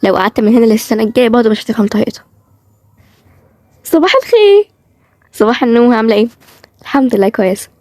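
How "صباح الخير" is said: high, sugary greeting; mannered high good morning; affected voice. Acted. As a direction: chirp it in a fake sweet tone. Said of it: "بطريقتها المستفزة اللي كل شوية تتغير".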